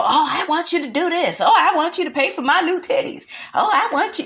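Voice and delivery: a hint of a demanding mom asking for things; mock demanding mother. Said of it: "she's just, like, very demanding".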